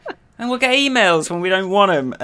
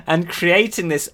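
ordinary talk, nothing marked

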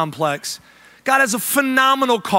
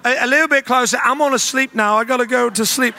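higher, put-on British voice; flustered mock-British, pitched up; British accent; 6 semitones higher than usual. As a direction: dodge questions in a fake British accent. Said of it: "I had to stay in character".

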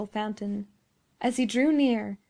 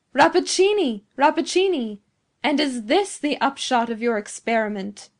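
loud triumphant horror; 2 semitones higher, 7 dB louder than usual. A narrator's reading. Professor at the window to thunder-stricken scientist, loud triumphant horror. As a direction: call out in triumph mixed with horror. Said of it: "called loudly, in a tone of triumph mixed with horror".

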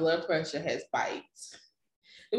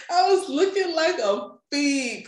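high, giggling; laughing; 11 semitones higher, 5 dB louder than usual. Real, not acted. Joking to a friend about her look, laughing high. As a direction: laugh at yourself.

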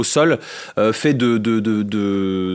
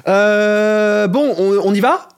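awkward guy voice; 10 semitones higher, 4 dB louder, much slower than usual. Acted.

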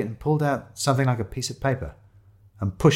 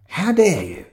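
high-pitched outrage; indignant hiss; 9 semitones higher, 3 dB louder, slower than usual. A narrator's reading. Shiloh to photographer calling them homeless, indignant. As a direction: take great offence. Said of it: "hissed Shiloh".

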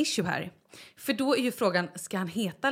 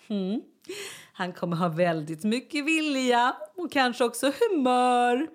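slow, higher sing-song verdict; imitated midwife; 5 semitones higher, 3 dB louder, slower than usual. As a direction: draw it out high and knowingly as the midwife. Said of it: "Hon bara". (härmad barnmorska, långsamt och ljust)

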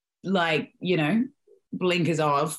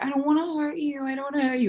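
much higher, fast, put-on worried voice; mock timid co-star pleading; imitating Alyssa; 10 semitones higher, much faster than usual. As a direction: fret sweetly like a nervous co-star. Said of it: "Alyssa was like".

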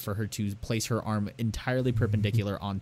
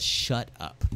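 hushed retort; 4 dB quieter than usual. Retold or imitated. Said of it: "And she says".

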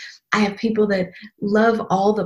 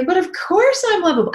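much higher, lightly sung-out certainty; bright self-assurance; 10 semitones higher than usual. Retold or imitated. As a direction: toss it off high as if obvious. Imagined self-assured inner voice.

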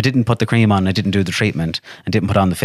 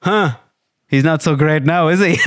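smug, higher and laughing taunt; gloating mock; 8 semitones higher than usual. Husband mocking Alan to his wife, smug and laughing.

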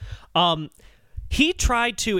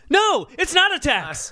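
incredulous retort; 11 semitones higher, 3 dB louder, slower than usual. Real, not acted. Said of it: "retort to the [name] quote".

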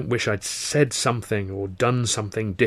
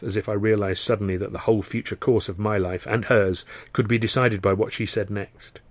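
usual speaking voice

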